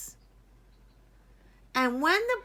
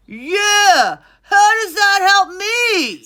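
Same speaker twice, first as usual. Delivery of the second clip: loud high defiant shout; jeering crowd; 9 semitones higher, 13 dB louder, slower than usual. Acted. Crowd member heckles the king, loud defiant shout.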